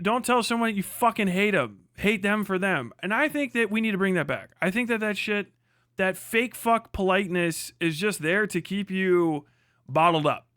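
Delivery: usual speaking voice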